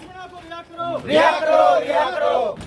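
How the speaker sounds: high-pitched shouted protest slogan. Real, not acted. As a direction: chant it at the top of your lungs. Protest crowd chanting slogans. (ज़ोरदार नारेबाज़ी)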